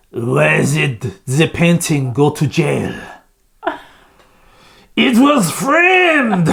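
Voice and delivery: slow comic French, soaring on the punchline; hammed-up French with drawn-out finish; French accent. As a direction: tell the joke like a Frenchman. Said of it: "okay, French".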